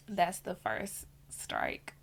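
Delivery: slow hushed whisper. Real, not acted. Telling viewers the marriage's first strike, whispered. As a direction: breathe it out slowly, secret-like. Ominous closing remark.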